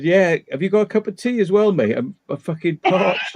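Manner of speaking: retelling his own cheeky line, laughing; high, half-laughing mock request; laughing through a quote. Telling friends his cheeky line to the owner, laughing. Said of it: "I said".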